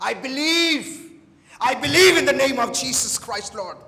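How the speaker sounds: loud, high declaration of faith; fervent prayer. Real, not acted. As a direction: cry out belief to God with full force. (पूरे जोश से विश्वास की घोषणा)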